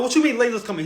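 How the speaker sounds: can't believe what he's hearing; sudden high-pitched alarm; alarmed disbelief. Retold or imitated. Panicked confusion on the phone.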